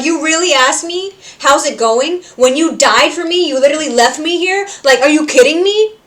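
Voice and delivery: high, loud indignant scolding; indignant rant. Retold or imitated. Cherry scolding Pentious, high, loud and indignant. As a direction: scold him in disbelief. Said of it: "she's like".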